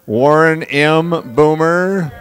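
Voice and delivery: loud, stretched slow call; calling out name. Real, not acted. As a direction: draw the name out, big and high. Calling graduate up on stage.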